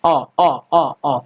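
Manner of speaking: औ repeated as a short calling sound; cow-calling sound. Shown as a demonstration. Teacher imitating calling cows to teach औ. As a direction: call औ, औ as if to a cow. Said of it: "जैसे हम लोग गाय वगैरह को बुलाते हैं ना".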